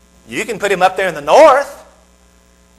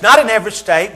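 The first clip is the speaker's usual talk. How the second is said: ordinary talk, nothing marked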